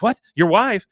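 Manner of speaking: high, loud mock shock; shocked question. Woman learns his wife is home, high shocked question. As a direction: imitate a startled woman, jumping up in pitch.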